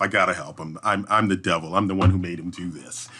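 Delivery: usual speaking voice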